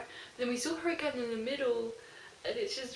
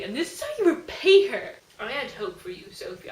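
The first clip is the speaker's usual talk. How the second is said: outraged; 10 dB louder than usual. Real, not acted. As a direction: tell off the traitor, half laughing. Telling off Sophia.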